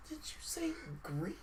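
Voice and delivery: whispered, high and laughing in disbelief; incredulous whisper. Retold or imitated. Wife whispering disbelief at Kratos crying out Greece. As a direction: hiss it like you misheard something absurd.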